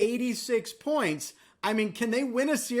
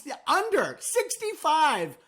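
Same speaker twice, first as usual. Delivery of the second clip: higher, slow incredulous emphasis; amazed emphasis; 5 semitones higher, slower than usual. Real, not acted. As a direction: hammer the number in disbelief, pitched up. Amazed at the low total.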